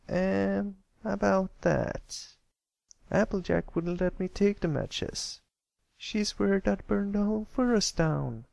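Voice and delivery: high, hesitant filly, drawn out at first; Applebloom, high sheepish voice. Acted. Applebloom confessing she has no matches, high sheepish filly voice. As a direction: own up to forgetting, bit by bit.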